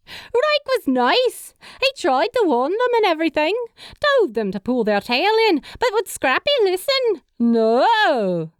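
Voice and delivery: high, sing-song mocking tease; teasing mimicry. A narrator's reading. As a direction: mimic them with teasing glee. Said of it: "Abby's tone took a similar inflection".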